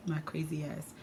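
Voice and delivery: ordinary talk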